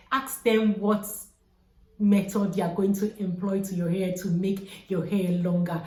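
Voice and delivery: normal talking voice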